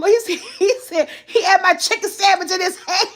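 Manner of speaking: telling it through real laughter; laughing while retelling the son's complaint. Real, not acted. Mom retelling to listeners, laughing. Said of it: "I died".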